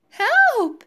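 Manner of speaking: very high, loud cry for help; panicked cry. A narrator's reading. Wife lifting off for the first time, panicked cry. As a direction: shriek it in fright. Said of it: "she cried".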